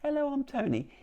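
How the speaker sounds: tiny high-pitched key voice, softly; Tony, little whisper. Acted. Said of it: "if it can hear Tony going".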